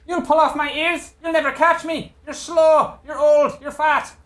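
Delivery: very high, jeering shout from above; high taunting lookout. Acted. Crow's nest fellow mocking the Goliath, high taunting shout. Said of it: "there is a fellow in the crow's nest".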